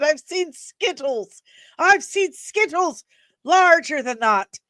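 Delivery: deadpan Irish-flavoured friend, hammed up; Irish friend imitation. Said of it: "And he said".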